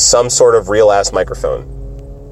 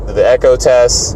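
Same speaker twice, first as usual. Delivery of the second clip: usual speaking voice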